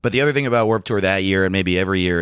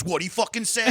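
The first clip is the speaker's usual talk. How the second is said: much higher, hammed-up challenge; imitating a tough kid; 12 semitones higher, 5 dB quieter than usual. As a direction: pitch it up like a teen picking a fight. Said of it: "another kid comes up behind him and goes".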